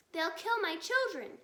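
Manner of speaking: high, loud cry of fear; frightened cry. Acted. Fox mother fearing for her cubs, crying out. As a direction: cry out in terror for her children. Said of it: "cried Missus Fox".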